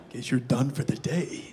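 low, slow impression of the director; Clint Eastwood. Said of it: "And he goes".

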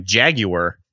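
"jaguar" in three syllables, "jag-you-er"; imitated British pronunciation. How the language's inventors say it, "jaguar" as "jag-you-er". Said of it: "The people that invented the language".

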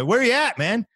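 high teasing put-on voice; high mock voice of Brian. Comic impersonating Brian calling him a thief, high and playful. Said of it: "he goes".

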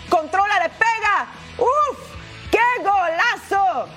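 rising excited goal call; breakneck goal commentary. Real, not acted.

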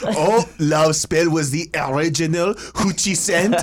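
mock-French accent with laughs around it. Teasing about a scent in French accent. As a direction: joke in a put-on French accent. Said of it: "I'm stuck in French right now".

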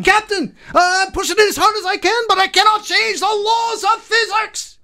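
high, strained Scottish engineer; Scotty. Acted. Engineer warns captain of engine limits, high Scottish voice. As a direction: protest to the captain in a Scottish accent. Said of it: "Scotty from Star Trek?".

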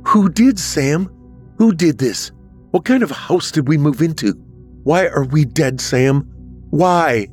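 high, distressed demand for answers; distraught questioning. A narrator's reading. Olivia demands answers from Sam about their deaths, distraught.

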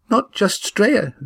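put-on high, earnest girl's voice; young Eva. A narrator's reading. Eva warning the friends, high worried voice.

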